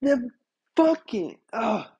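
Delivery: exasperated, bursting out on fucking; retold angry outburst, halting into an ugh. Customer reacting to the barista's explanation, angry. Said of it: "I was angry, dude".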